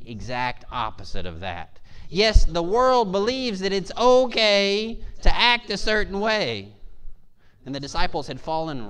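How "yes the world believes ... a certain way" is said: high, loud, slow preaching; impassioned preaching. Real, not acted. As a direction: declare it with full conviction. Preaches at full force.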